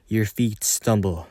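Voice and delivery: usual speaking voice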